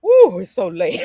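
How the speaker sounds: loud, yawning groan on the words; hammed-up sleepy yawn voice; yawned speech. Shown as a demonstration. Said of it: "Yawn down to me like".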